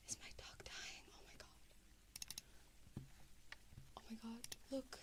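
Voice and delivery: usual speaking voice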